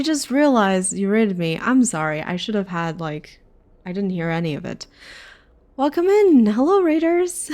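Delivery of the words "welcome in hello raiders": high, loud greeting; excited welcome. Real, not acted. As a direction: welcome the raiders loudly, pitched well up. Streamer greets incoming raiders.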